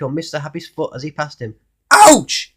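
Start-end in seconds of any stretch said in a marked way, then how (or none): 1.9-2.5 loud, high yelp of pain; exclaimed ouch. Acted. Mister Happy yelps when Grumpy steps on his foot. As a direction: cry out as your foot is trodden on. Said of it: "exclaimed Mister Happy".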